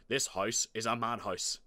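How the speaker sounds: loud fatherly complaint; exasperated dad. A narrator's reading. Dad fed up with his squabbling sons, loud complaint. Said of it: "said Dad".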